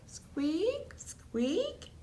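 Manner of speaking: tiny high hamster squeak, squeak. A narrator's reading. Humphrey squeaking to Saeed, tiny high squeaks. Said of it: "All she heard was squeak".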